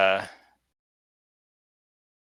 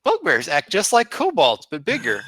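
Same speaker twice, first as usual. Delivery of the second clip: kobold voice; 7 semitones higher than usual. Acted. Meepo sizing up the two bugbears, high kobold voice. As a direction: talk down like a proud little kobold. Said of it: "he says".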